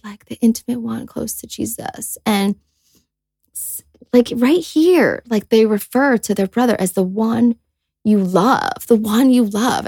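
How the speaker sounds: normal talking voice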